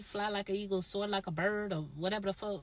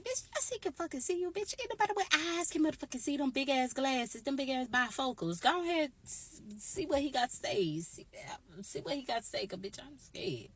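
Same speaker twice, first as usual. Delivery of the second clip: mock frantic reply; 7 semitones higher than usual. Teller acts frantic reply to friend.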